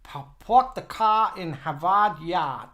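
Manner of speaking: slow try at a Boston accent, r's dropped; careful, drawn-out Bostonian attempt. Shown as a demonstration. Host repeats the Boston phrase, slow and careful. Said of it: "giving us a phrase in Bostonian".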